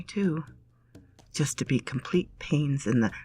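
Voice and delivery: ordinary delivery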